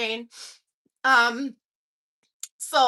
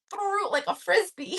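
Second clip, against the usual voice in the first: laughing; 6 semitones higher, 3 dB quieter than usual. Real, not acted. Laughing while telling.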